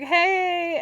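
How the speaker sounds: high, loud, hammed-up hello; mock casual greeting. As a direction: sing out a breezy greeting, imitating her. Acting out characters' casual reunion greeting.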